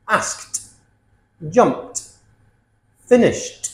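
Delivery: "Asked, jumped, finished" each ending in a crisp t. Shown as a demonstration. Teacher models the T sound, "Asked, jumped, finished" ending in t. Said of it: "T or T".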